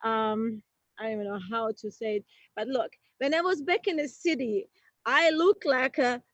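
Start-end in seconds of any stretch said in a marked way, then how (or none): none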